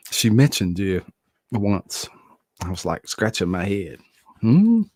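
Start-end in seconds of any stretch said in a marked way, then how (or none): 4.4-4.8 surprised hmm. Real, not acted. Puzzled reaction.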